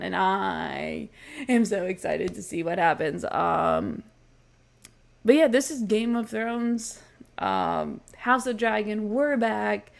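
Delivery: ordinary delivery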